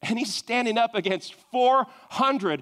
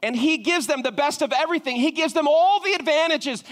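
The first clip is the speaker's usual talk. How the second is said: ordinary talk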